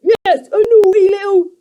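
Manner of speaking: very high, loud creature cry; a goblin scolding the girl; crying creaker. A narrator's reading. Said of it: "Sniff cried".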